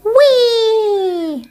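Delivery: high joyful whee; gleeful whee. A narrator's reading. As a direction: squeal it with delight. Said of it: "said Posy".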